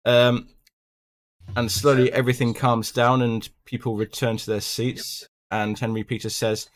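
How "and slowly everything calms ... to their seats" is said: ordinary talk, nothing marked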